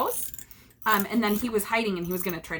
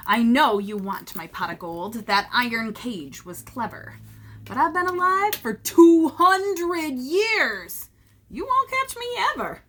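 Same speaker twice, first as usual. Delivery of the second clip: boastful leprechaun; 2 semitones higher, 4 dB louder than usual. Acted. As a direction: crow that nobody will catch you.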